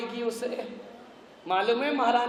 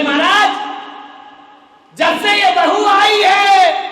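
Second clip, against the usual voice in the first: wailing complaint; 11 semitones higher, 13 dB louder, slower than usual. Retold or imitated. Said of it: "रोते रोते आई".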